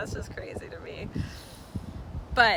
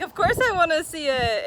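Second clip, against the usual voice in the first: higher and louder, bursting with eagerness; eager yes; 7 semitones higher, 7 dB louder than usual. Retold or imitated. [name] telling viewers how eager she was, excited. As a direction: jump at the offer. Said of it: "And I'm like".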